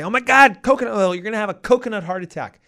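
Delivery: high, loud, panicked doctor; mock cardiologist panic. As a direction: freak out like a frantic cardiologist. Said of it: "is gonna panic and freak out and say".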